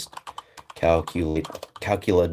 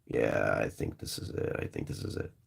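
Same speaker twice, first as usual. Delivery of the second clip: muttering; 11 semitones lower, 11 dB quieter than usual. Real, not acted. Muttering to self, searching.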